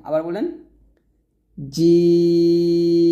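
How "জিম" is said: জিম with a very long, steady i; four-alif elongation. Shown as a demonstration. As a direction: hold the vowel of জিম, then hum shut on m. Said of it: "চার আলিফ টান দিব". (জিম চার আলিফ টানে)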